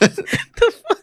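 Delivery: high laughing what the fuck; laughing disbelief. Real, not acted. Stunned laughing reaction.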